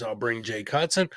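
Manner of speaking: ordinary delivery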